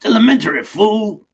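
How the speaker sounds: hammed-up loud, high tough-guy Watson; Mister T. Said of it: "playing Watson as played by Mister T".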